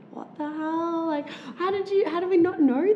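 high, disbelieving exclamation; amazed. Retold or imitated. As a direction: act blown away and delighted. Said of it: "they're like".

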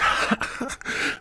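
high mocking cackle of friends; high-pitched taunting laugh at his loss. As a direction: cackle at him like teasing buddies.

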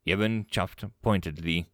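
ordinary delivery, nothing marked

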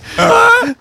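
high, loud real laugh. Real, not acted. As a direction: laugh out loud, caught off guard. Still laughing.

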